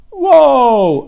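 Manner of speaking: high, loud drawn-out whoa; startled whoa. A narrator's reading. Charlotte catching herself mid-spin, loud startled whoa. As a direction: catch yourself as the line swings.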